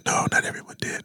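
unvoiced hushed whisper. Real, not acted. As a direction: lean in and whisper it. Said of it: "I'm gonna try to whisper".

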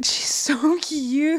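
slow, high cooing. Real, not acted. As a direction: coo over a cute kitten photo. Cooing at cat photo.

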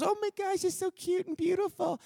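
put-on high, soft cooing girlish voice; cooing princess. Princess finding baby Moses, high soft coo. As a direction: coo over the baby in a falsetto.

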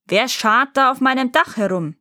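moderately bellowed challenge; giant's roar. A narrator's reading. Giant to the children on his roof, roaring. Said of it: "brüllte der Riese".

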